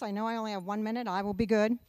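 normal talking voice

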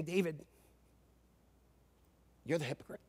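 gently but firmly name his sin; a hushed prophet's rebuke; quiet rebuke. Retold or imitated. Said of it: "He was saying".

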